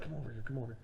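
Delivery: imitated discreet summons, barely voiced; hushed beckoning. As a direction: beckon her over under your breath. Man beckons her over discreetly.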